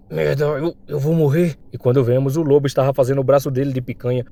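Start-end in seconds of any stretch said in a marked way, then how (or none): 0.1-1.5 halting, strained words of a wounded man; in pain. Acted. Injured comrade on the ground fearing death, pained. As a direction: stammer through the hurt. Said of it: "sentindo muita dor".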